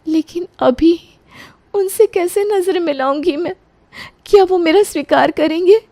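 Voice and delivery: put-on young daughter-in-law. बहू का अलग किरदार.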